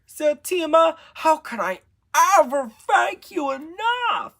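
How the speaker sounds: high, loud gush of thanks; overjoyed. A narrator's reading. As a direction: burst out with joyful gratitude. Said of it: "and overjoyed".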